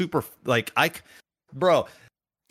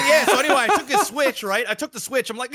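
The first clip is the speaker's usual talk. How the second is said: usual speaking voice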